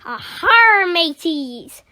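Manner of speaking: loud, high pirate hail; hearty pirate call, easing at the end; pirate captain greeting. Acted. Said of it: "Captain Bertie greeted them".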